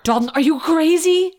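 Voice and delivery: high, loud disbelieving scold; loud exasperated scolding. Pretend. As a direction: shout at him in disbelief. Character scolds paper eating.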